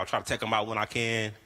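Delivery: ordinary talk, nothing marked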